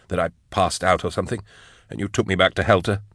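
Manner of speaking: quick, softened character voice; a hint of anxious hope; lightly voiced shaken young follower. A narrator's reading. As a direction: plead softly, in a hurry. Character's line in narrated story.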